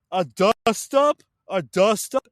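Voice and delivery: scornful echo of a quoted word; incredulous scoff. Real, not acted. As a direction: repeat the phrase as if it's absurd.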